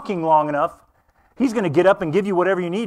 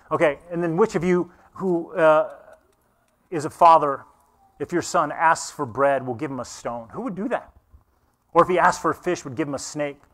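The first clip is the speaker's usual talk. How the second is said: ordinary delivery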